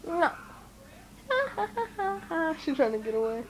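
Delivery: usual speaking voice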